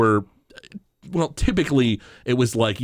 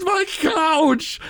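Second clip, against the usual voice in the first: shouting; 14 semitones higher than usual. Retold or imitated. Homer discovers the wrecked couch, high mock shout. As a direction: wail it like your couch just died. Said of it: "he's like".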